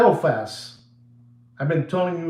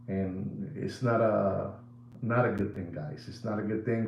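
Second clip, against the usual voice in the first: grave quiet; 6 semitones lower, 9 dB quieter than usual. Real, not acted. Grave warning to viewers.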